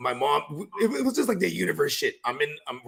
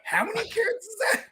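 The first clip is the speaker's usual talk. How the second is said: surprised. Retold or imitated. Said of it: "And you're like".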